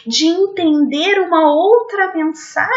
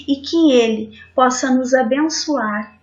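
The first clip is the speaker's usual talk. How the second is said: closing prayer; 4 semitones lower than usual. Real, not acted. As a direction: bless the listeners gently, like a benediction.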